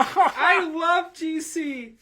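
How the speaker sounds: high, very slow laughing gush; mock gush. Reading the heroine's gush, laughing. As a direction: gush like a starstruck fan.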